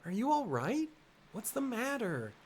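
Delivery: puzzled, chiding friend in a lifted voice; concerned girl game character. Acted. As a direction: fuss over a friend who just passed out.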